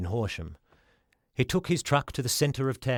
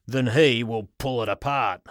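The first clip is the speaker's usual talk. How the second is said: farmer voice; 5 dB louder than usual. Acted. Farmer challenges Giffen.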